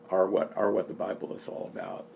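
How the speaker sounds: normal talking voice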